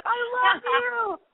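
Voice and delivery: high, overwrought love confession; dramatic declaration. Pretend. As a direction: declare it melodramatically. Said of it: "get on a ledge and be like".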